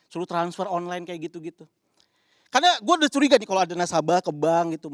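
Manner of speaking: ordinary delivery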